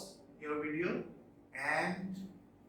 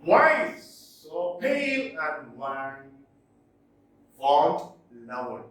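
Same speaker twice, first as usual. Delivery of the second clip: slow, stressed reading of a verse line; teacher reading a verse example aloud; poem recital; 4 dB louder, slower than usual. Shown as a demonstration. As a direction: linger on each stressed beat. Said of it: "One more example".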